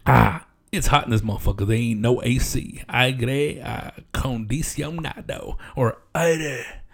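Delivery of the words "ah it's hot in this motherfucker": ordinary delivery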